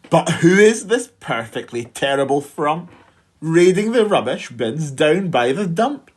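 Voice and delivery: ordinary delivery, nothing marked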